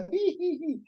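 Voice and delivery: high-pitched teasing "hee-hee" laugh; mock giggle. Teacher imitates a boy standing by laughing, mock giggle. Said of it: "你也就站在旁边笑".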